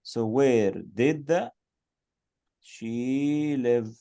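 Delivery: slow, with did and live drawn out; slow model sentence. Shown as a demonstration. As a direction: spell it out slowly for pupils. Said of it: "use was or were".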